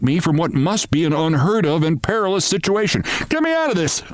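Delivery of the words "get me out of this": high bawling cry for help; bawled. A narrator's reading. Narrator trapped under his bed, high bawl. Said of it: "I bawled".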